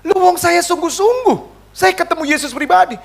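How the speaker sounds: high, insistent protest; retold anger. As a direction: re-enact an indignant self-defence. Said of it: "waktu itu saya marah".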